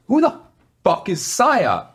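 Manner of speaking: imitating a furious crew chief shouting; retold yell. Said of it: "He was like".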